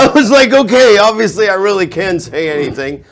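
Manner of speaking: high and loud, still laughing; triumphant retelling, voice up; excited recalling he could say anything. Real, not acted.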